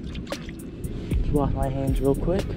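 ordinary talk

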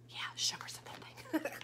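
unvoiced secret whisper. Real, not acted. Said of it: "conspiratorial aside to kids".

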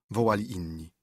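ordinary delivery, nothing marked

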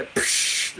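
short whooshing "psh" noise; imitated lighter flare. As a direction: make the hiss of a lighter igniting.

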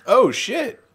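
loud, high excited exclaim; surprised shout. Real, not acted. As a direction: blurt it out, amazed. Reacting to new spear.

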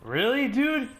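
high, loud disbelief; outraged really dude; exasperated disbelief. Real, not acted. Exasperated at being killed.

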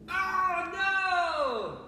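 high, loud, drawn-out mock wail; mock dismay. Coach catching kids in the game, mock dismay. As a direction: play up a big pretend disaster.

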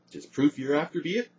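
high, loud indignant question; affronted, rising challenge; offended snarl. A narrator's reading. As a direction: snarl it, stung. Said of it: "snarled Morgan, offended".

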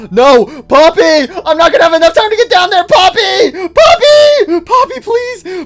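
rising into frantic high yelling; desperate shout, building. Real, not acted. Poppy falling before he can reach her, desperate building shouts. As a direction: call after her in panic.

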